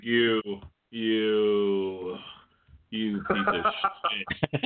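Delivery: halting, pointed mock scolding; mock outrage. Imagined officer rebuking the rider, drawn-out mock disgust. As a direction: stretch out each accusation with pauses.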